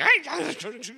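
loud, high barked German gibberish; hammed-up German-sounding shouting; mock aggressive German. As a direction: imitate harsh German as wordless noise. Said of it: "aggressive language".

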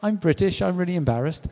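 high-pitched awkward confession; embarrassed Brit. Acted. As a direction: squirm as a mortified British visitor. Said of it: "you're sitting there kind of going".

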